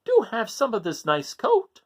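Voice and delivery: high insistent mother voice; Mother Goat. Acted. Mother offering her son a coat, high and insistent. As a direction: press the coat on him warmly. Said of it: "Mother Goat said".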